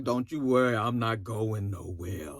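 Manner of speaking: mock his sluggish low voice; stupid drawling voice.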